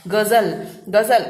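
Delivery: गजल said gazal with z; गजल with a buzzing z; z sound. Shown as a demonstration. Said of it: "जेड sound सुनाई देता है". (ग़ज़ल में ज़ की ध्वनि)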